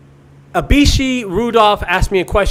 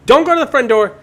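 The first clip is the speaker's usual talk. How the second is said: urgent warning; 6 semitones higher, 7 dB louder than usual. Retold or imitated. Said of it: "And I said".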